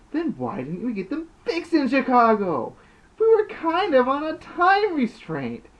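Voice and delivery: high, loud, aggravated yell. Acted. Larry aggravated with Snake over the glasses, shouting high.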